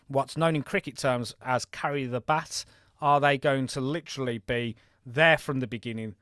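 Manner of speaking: normal talking voice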